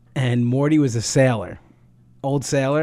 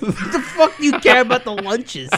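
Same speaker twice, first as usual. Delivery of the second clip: mock incredulous; 9 semitones higher than usual. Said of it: "Drew's like".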